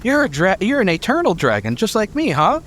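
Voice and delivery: high, eager little dragon voice; thrilled to meet a fellow dragon; Peerless Ruby voice. Acted.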